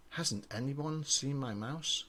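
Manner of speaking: usual speaking voice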